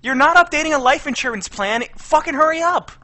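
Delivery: impatient. Real, not acted. Impatient at friend.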